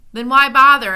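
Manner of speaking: loud, high alarmed cry; startled loud demand; alarmed shout. A narrator's reading. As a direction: blurt it out in alarm. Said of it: "asked Tok, whose alarm".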